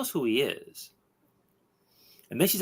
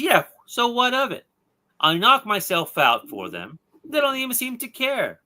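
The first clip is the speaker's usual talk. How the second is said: higher, louder defensive retort; indignant higher, louder comeback; defensive outburst; 13 semitones higher, 6 dB louder than usual. Retold or imitated. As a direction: snap back like a wronged hero. Deadman snaps back defensively.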